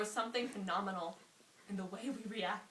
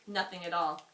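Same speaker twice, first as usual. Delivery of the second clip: ordinary delivery, nothing marked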